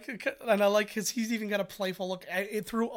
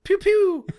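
very high, loud pew pew blasts; dad imitating his son's blaster noises; kid's pew pew; 14 semitones higher, 11 dB louder than usual. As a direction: chirp it like a little boy playing blaster. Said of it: "And he goes".

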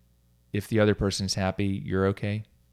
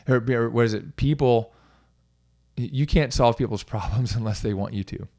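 ordinary talk

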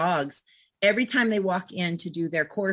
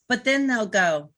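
ordinary talk

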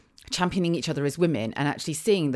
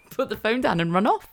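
ordinary delivery

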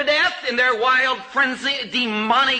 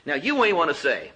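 ordinary delivery

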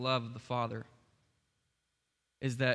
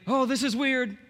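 high, loud mock-alarmed cry; spooked disciple; 11 semitones higher, 9 dB louder than usual. Preacher voicing disciples who never said this, mock alarm. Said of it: "they didn't say".

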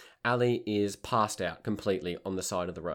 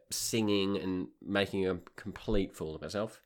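normal talking voice

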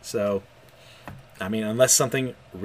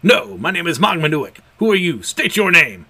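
commanding; 5 semitones higher, 7 dB louder than usual. Acted. Eldritch being demands a name.

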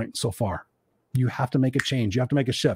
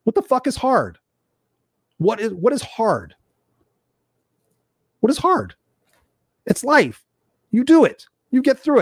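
high, incredulous rant; pitched-up exasperated what-the-hell; exasperated outburst; 7 semitones higher than usual. Real, not acted. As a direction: scoff at the excuse in disbelief.

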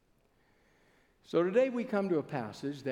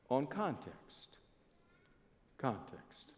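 on context slowed, then Context alone, soft and low; soft emphasis; 4 semitones lower, 10 dB quieter, much slower than usual. Shown as a demonstration. Preacher driving home Context to the congregation, soft and low. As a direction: let Context land softly. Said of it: "preacher stressing key word".

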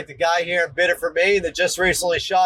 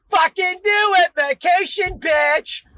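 fed-up parent yell; 12 semitones higher, slower than usual. Retold or imitated. Parent daring the kid to call DHS, screaming. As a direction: scream the dare at the kid, dragging it out. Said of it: "you just wanna say".

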